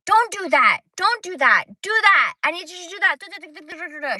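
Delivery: high, loud barking orders; mock a bossy busybody yelling commands; shrill-free loud high mock nagging; bossy lady impression. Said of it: "a lady who likes to tell everybody what to do".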